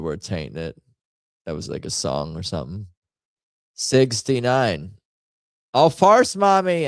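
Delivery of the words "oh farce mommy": shrieked high and loud; high loud yelp. Real, not acted. Streamer reacting to the game, a high loud yelp. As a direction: yelp it out in surprise.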